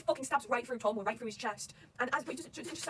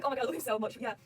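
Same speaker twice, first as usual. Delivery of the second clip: ordinary talk, nothing marked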